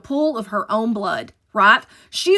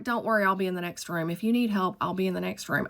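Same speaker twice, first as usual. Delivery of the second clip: quick, softer, offhand promise; chief's easy reassurance; 3 semitones lower, 8 dB quieter, faster than usual. Retold or imitated. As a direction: toss it off, quick and soft. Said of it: "is like".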